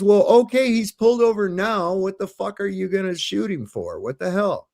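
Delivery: high-pitched, indignant comeback; retold exasperated retort. Father arguing with cop over traffic stop, retold exasperated retort.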